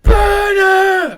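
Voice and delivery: high shouting mob cry; angry mob leader. Acted. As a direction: rouse the mob to burn the witch.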